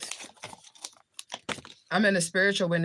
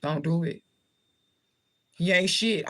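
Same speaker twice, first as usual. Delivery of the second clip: usual speaking voice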